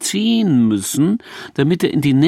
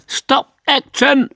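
high drawn-out shout of a film supervisor; 13 semitones higher, 4 dB louder, slower than usual. Acted. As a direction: bark the set command slowly. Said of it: "Plötzlich schrie der Supervisor". (Supervisor ruft am Set laut und gedehnt)

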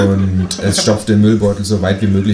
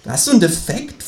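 kangaroo calling out; 9 semitones higher than usual. Acted. Kangaroo mocking the narrator, high indignant call. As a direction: fire it back as the outraged kangaroo. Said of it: "ruft das Känguru".

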